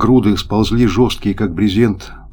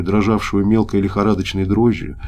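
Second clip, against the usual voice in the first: ordinary delivery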